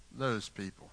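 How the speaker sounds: dropped low, soft and dismissive; disdainful. Retold or imitated. Preacher showing how they belittle outsiders, quiet disdain. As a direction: wave them off under your breath. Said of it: "catch it like that".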